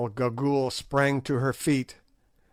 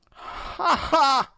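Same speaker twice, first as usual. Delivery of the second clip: high piping witch cackle; shrill crone's laugh; piped cackle; 4 dB louder than usual. A narrator's reading. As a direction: cackle it thin and high like an old hag. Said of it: "she piped".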